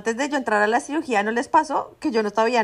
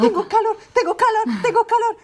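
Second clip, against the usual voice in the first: crazed yelling; 11 semitones higher, 4 dB louder than usual. Retold or imitated. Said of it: "Empecé a gritar como loca".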